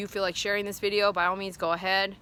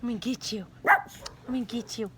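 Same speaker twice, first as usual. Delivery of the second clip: playful pet talk; 8 semitones higher than usual. Real, not acted. Owner playing with her dog Faith, playful baby talk. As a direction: play lovingly with a kissy dog. Playing kissing games with dogs.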